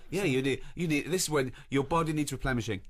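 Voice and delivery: normal talking voice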